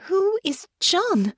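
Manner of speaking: high, slow, insistent question; high-pitched, drawn-out demand. A narrator's reading. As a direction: demand an answer, stretching the words. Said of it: "Honor demanded".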